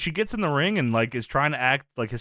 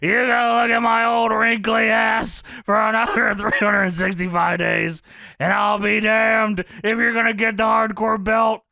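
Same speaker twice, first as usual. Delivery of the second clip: louder, higher mock yell; Funk defiant; 5 semitones higher, 4 dB louder than usual. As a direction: spit it out defiantly at Shane. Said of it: "Funk says".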